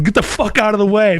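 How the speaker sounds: high mock yell at a cyclist in the way; retold yell. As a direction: bark it like an angry cyclist, high and loud. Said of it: "He yells at me".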